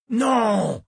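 sudden roared no; roaring. A narrator's reading. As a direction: bellow a sharp no. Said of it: "Rugit Jimmy Wayne Sutter".

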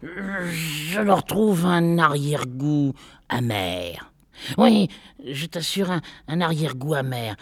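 complaining husband. A narrator's reading. Husband complains of bitter taste.

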